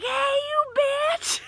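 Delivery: very high, loud, drawn-out shriek; squealed greeting. Retold or imitated. Running to hug a friend at first meeting, squealing. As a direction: squeal it to a long-lost friend, re-enacted.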